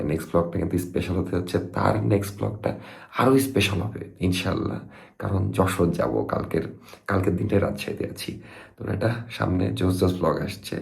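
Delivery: drifting off while signing off; nodding off. Real, not acted. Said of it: "কারণ আমি ঘুমে ঢলে পড়ছি".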